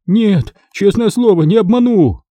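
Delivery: high-pitched pleading woman; pleading promise. Acted. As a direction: swear it desperately, high in pitch. Captive swears she will not cheat.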